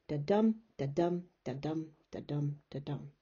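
five "da-dum" feet, stepping down and fading; unstressed-stressed beat. Shown as a demonstration. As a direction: count out each "da-dum", weight on "dum". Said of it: "five lots of Unstressed, stressed".

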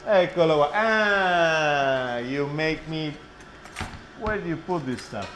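ordinary delivery

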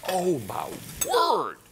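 slow, drawn-out gasp of awe; awed. Real, not acted. Host marvelling at the pan he loves, slow and awed. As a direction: savour each word in wonder.

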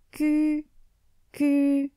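syllabes hachées, cherchant du doigt dans le livre; hésiter en épelant le nom; bégaiement hésitant. Acted. Saint-Pierre cherche dans le livre. (Saint-Pierre cherche le nom en bégayant)

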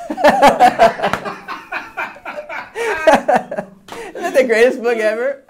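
high, laughing delight. Real, not acted. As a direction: laugh through the question. Laughs after book.

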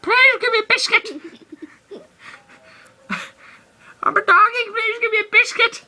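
high, whiny puppy-dog begging; begging doggy. Pretend. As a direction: beg like a pleading little dog. Pretends to be a doggy.

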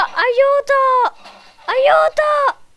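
mock cries for help. Voicing the character.

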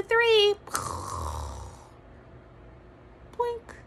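high silly boink; cartoon landing sound; playful boink. Shown as a demonstration. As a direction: land the rocket with a high boink. Rocket landing sound effect.

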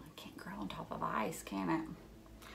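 whispered, a bit quick; quiet whispered question; hushed question. Pretend. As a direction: softly ask the kids.

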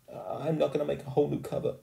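put-on high, quick girl's voice; reluctant schoolgirl. A narrator's reading. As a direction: object as a girl who has given in. Sheila's girl voice.

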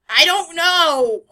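loud, slow, drawn-out cry; exasperated shout. Real, not acted. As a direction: wail it out. Flustered outburst.

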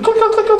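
high, loud imitated mouse clicks; playful clicking noises; mock clicking sounds. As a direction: tap out clicks with the voice.

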